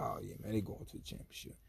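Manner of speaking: near-whisper, breathy and soft; whispered fan certainty. Retold or imitated. Fans sure the Bulls reach the championship, whispered. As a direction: whisper it like everyone already knows.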